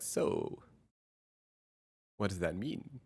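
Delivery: soft, breathy probing therapist question. Retold or imitated. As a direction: ask it like a gentle therapist. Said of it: "I'm like the unlicensed therapist".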